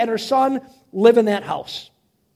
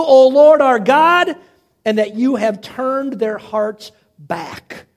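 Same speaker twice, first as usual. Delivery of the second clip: impassioned prayer; 2 semitones higher, 5 dB louder, slower than usual. Retold or imitated. Elijah asking the Lord to answer before the people, praying. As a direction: pray it slowly, swelling toward "are God", then easing. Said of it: "this is what he prays".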